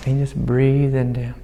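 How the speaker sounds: normal talking voice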